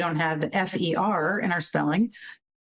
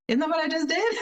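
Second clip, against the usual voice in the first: put-on puzzled child; 10 semitones higher, faster than usual. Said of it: "It's like".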